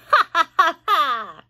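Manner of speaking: loud high taunting cackle; hammed-up witchy laugh; mocking cackle. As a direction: cackle at her sister's expense. Aunt cackles at her sister.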